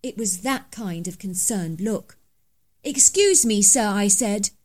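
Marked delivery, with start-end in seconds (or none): 2.8-3.9 louder, higher call to a stranger; firm call out. Acted. Narrator confronting poacher on private land, loud firm call. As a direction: hail the trespasser firmly.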